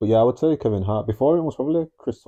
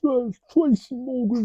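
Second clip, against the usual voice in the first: slow, much higher attempt at an American accent; clumsy American accent try; mock American accent; 13 semitones higher, 4 dB quieter, slower than usual. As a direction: stretch the name out in a bad American accent. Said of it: "that sound like from London".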